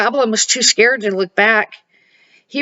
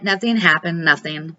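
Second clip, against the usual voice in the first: ordinary delivery, nothing marked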